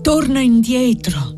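high, slow, breathless warning; panting urgency. A narrator's reading. Frightened girl warns Annessa to turn back, panting. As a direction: urge her back in a lifted, winded voice. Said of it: "disse la fanciulla con voce ansante".

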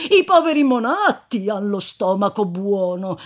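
bow and scrape in jest to the dead master; mock servile reverence. Corpse-carrier mocking the dead rich man, fake servile bow. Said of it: "fece una riverenza da quella parte".

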